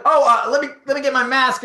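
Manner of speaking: high, loud, stumbling mock fluster; flustered diner. Diner caught unmasked by the returning server, flustered. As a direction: act caught out, voice jumping up.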